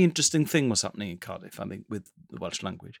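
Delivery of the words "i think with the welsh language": ordinary delivery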